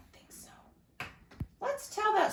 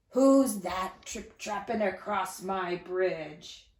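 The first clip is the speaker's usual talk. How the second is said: slow, lower grumpy troll voice; a storyteller's grumpy troll asking who is on the bridge; 4 semitones lower, 3 dB louder than usual. Acted. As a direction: growl it out like a grumpy old troll.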